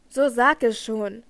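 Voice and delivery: loud, slow, grim demand; grim call. A narrator's reading. Raoul urges faltering Christine on, grimly loud. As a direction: press it out forcefully and grimly. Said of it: "rief Raoul grimmig".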